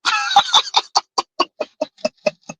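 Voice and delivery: shrill helpless laugh; high-pitched cackle; high squealing laugh. Real, not acted. Laughing at clip.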